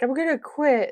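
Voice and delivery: mock-dramatic threat to quit. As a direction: throw it out like a diva storming off. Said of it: "just get, like, really dramatic".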